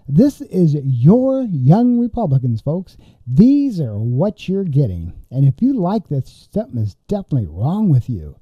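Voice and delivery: ordinary delivery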